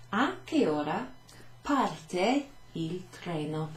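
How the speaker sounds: slow and clear, parte drawn out; careful slow teacher model sentence; slow teaching read. Shown as a demonstration. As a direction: spell out "parte il treno" slowly for learners. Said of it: "At what time does the train".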